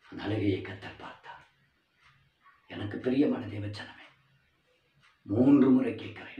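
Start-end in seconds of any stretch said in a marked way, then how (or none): none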